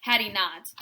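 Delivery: usual speaking voice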